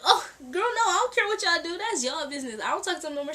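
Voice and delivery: high, loud brush-off; loud retold protest. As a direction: act out an emphatic denial. Retold protest of not caring.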